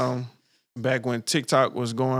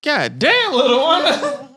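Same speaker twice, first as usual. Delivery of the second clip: high laughing exclamation; 14 semitones higher, 5 dB louder, much slower than usual. Real, not acted. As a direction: squeal it while cracking up. Laughing reaction.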